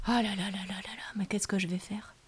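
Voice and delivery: worried whisper; whispered worry. Acted. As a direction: fret under your breath. Child fretting at night.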